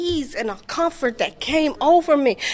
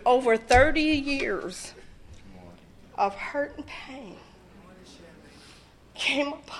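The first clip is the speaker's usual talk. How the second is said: quiet and heavy; 6 semitones lower, 8 dB quieter, slower than usual. Real, not acted. Recalling decades of hurt.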